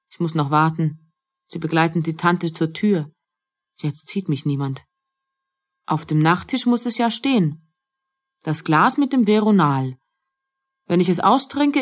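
usual speaking voice

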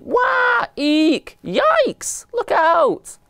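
very high squeaky shrieks; panicked squeals. A narrator's reading. Mice sent flying by mummy elephant, panicked squeals. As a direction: squeal each cry as if flung away.

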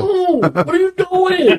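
high, loud and laughing; dad laughing at his son's choices, high and loud; laughing disbelief. Real, not acted.

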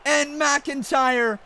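excited wrestling commentator calling the action; hyped ringside play-by-play; play-by-play commentary. Acted. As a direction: call the match like a ringside announcer.

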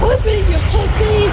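taunting shout. Acted. Taunting enemy over proximity chat.

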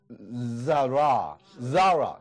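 Zara said slowly, weight on ZA; stress first syllable. Shown as a demonstration. Teacher showing the stress on a name, slow. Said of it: "重音在前面".

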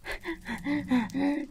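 quiet, breathy chuckle after a scare; nervous laugh. Real, not acted. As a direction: laugh it off softly. Relief after ghast attack.

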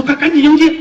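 high, loud hurried order; urgent command. Acted. Officer ordering his men to greet the guest, loud and high. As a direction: snap out the order to hurry and greet. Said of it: "啊，说：".

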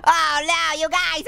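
ordinary talk, nothing marked

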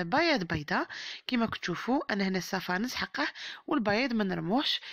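normal talking voice

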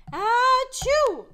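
a big high achoo; loud sneeze. Pretend. Prince allergic to the perfume, loud sneeze. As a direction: sneeze hard.

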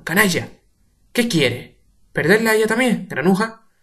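shouted rage; angry shouting. Acted. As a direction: hurl the insults. Urbano insulting Pepe.

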